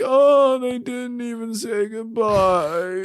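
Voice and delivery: put-on a small, hurt old man voice said slowly; quiet old man. Ornament Noel lamenting his lost friends, frail old man. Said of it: "quietly, like an old man".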